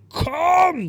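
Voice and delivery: hoch und laut „Komm!“ gebrüllt; brüll das Publikum an wie eine Schlagersängerin; gebrüllter Anfeuerungsruf. Retold or imitated. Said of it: "die hat da immer gebrüllt". (Sängerin auf der Bühne brüllt dem Publikum laut zu)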